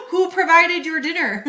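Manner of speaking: high, laughing retort; laughing jab. Real, not acted. Laughing at husbands who only provide money. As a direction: fire the question back through a laugh.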